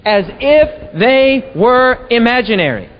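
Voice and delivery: drawn-out, very slow, every word weighed; slow read-along. Shown as a demonstration. Preacher reading the punchline with listeners, very slow. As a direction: lead the room through it slowly. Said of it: "read it with me".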